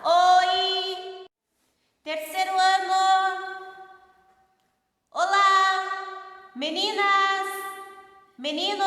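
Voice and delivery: loud calls ringing in an empty room; calling out to test the echo. Shown as a demonstration. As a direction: shout out and let it echo. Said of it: "Olha o eco aqui da sala". (chamando alto para ouvir o eco)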